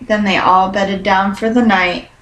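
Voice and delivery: ordinary delivery, nothing marked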